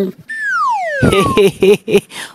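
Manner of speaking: high, scornful burst of laughter; mocking laugh. Inspector laughing off the gangster, scornful laugh.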